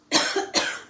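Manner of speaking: harsh unvoiced cough; real cough. Real, not acted. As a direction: cough into the room. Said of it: "Excuse me".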